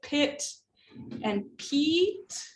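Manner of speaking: "pit" short, "peat" long and rising; vowel length contrast. Shown as a demonstration. Teacher showing vowel length, short "pit" against long "peat". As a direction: keep "pit" brief, stretch the vowel in "peat". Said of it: "It could be for vowel length".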